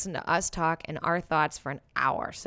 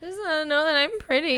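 high, mock-whining pretty plea; whiny sad voice; 7 semitones higher, 4 dB louder than usual. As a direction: whine it like a sulky teen.

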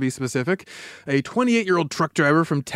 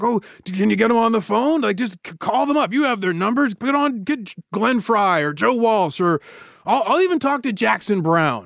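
high-pitched, halting plea as an imitated gunman; insistent bargaining; 6 semitones higher than usual. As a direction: haggle urgently, pitched well up. Gunman haggling for the band.

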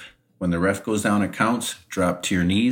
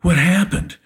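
mock winded; 11 semitones higher, 3 dB louder than usual. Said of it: "he's all blown up and winded".

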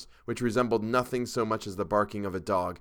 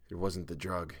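low, soft moan; weak moan; 3 semitones lower, 8 dB quieter than usual. A narrator's reading. Shaken Chalmers blaming something other than drugs, moaning softly. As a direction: moan it faintly, all strength gone. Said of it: "he moaned".

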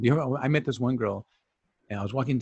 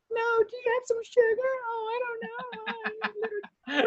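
extremely high, squeaky imitation; in-character dog voice; 4 dB quieter, slower than usual. Neighbor asked about sugar, squeaky dog voice. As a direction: squeak it out like baby-talk to a pup, slowing at the end. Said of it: "she's on character all the time".

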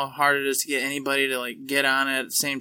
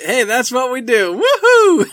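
louder hype whoop, pitch leaping much higher on woohoo; excited cheer; 8 semitones higher, 10 dB louder, slower than usual. Acted. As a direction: whip up the crowd with a big whoop. Host cheering his own gimmick.

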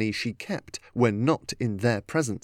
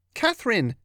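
high-pitched glad call without real volume; mild happy shout; 10 semitones higher than usual. A narrator's reading. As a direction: call her name brightly, smiling. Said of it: "she shouted, smiling".

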